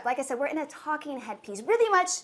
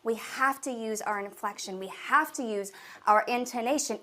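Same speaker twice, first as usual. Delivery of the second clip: ordinary delivery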